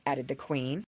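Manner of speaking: usual speaking voice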